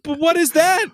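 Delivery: high, loud puzzled question; asking again, totally lost; baffled kid. Retold or imitated. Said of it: "And I was like".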